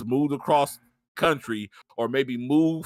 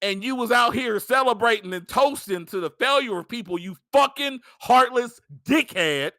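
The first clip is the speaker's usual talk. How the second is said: raised, indignant tirade; angry rant; 7 semitones higher than usual. Real, not acted. Berating absent Blake for celebrating layoffs, angry rant. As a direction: lay into him with the pitch pushed up.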